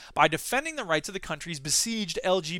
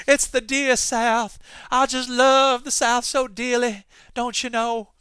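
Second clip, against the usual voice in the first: higher, slow, sugary Southern drawl; Southern belle accent; 4 semitones higher, 4 dB louder, slower than usual. Pretend. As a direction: gush like a genteel Southern lady. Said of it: "I'm doing my Dear South impression".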